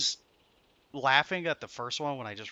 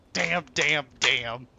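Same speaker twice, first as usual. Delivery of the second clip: usual speaking voice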